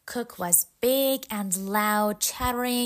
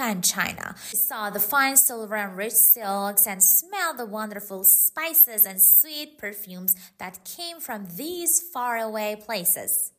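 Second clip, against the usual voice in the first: plain reading voice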